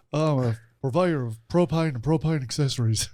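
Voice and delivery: Texan cartoon dad impression, lightly played; Hank Hill. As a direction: deliver it like the propane salesman from Arlen, Texas. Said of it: "I think it's more, uh, Hank Hill".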